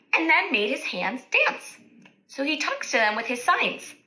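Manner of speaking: ordinary delivery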